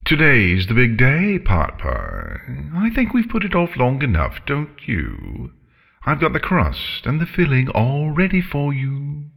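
lilting, high-swooping villainous dragon; chipper menacing dragon. Acted. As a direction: cheerily announce dinner to your victim. Voices the dragon Scarlet.

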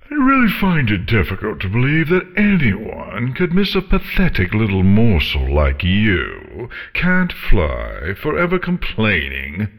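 haughty, theatrical dragon with high swoops; bored, contemptuous dragon drama; disdainful dragon. Acted. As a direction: belittle her with grand, swooping scorn. Dragon belittles crying pony.

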